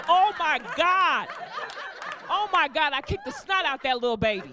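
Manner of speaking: loud, high, stretched exclamation; mock exclaiming. Comedian reliving kicking the baby, loud mock amazement. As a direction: belt it out in hammed-up amazement.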